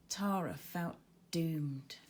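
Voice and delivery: plain reading voice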